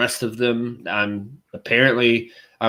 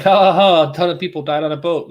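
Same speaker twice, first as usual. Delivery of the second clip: louder, higher mock cackle; mock laughing; 4 semitones higher, 4 dB louder than usual. Clarifying her dark humor, mock callous laughing.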